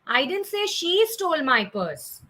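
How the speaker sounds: SHE pitched high, then a falling tail; stress on SHE. Shown as a demonstration. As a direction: punch the word she, let the rest drop. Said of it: "that she was the one".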